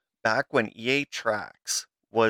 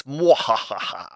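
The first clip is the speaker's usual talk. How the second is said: slow evil cackle; hammy villain laugh; mad scientist; 4 dB louder, slower than usual. Acted. Villain gag after controlled chaos.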